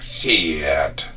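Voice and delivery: stretched Southern "Shit" in a light grandma voice; nanny's drawn-out cuss. Retold or imitated. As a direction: drag "Shit" out slowly like nanny. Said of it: "she'd be like".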